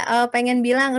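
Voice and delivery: ordinary talk, nothing marked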